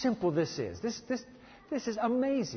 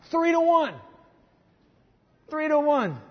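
emphatic refrain; 9 semitones higher, 5 dB louder than usual. Real, not acted.

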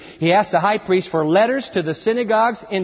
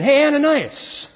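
high, slow raised call; loud summons from afar; 9 semitones higher, 4 dB louder, slower than usual. Acted. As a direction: holler the name to get attention. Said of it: "the Lord called to him in a vision".

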